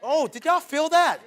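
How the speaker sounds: ordinary talk, nothing marked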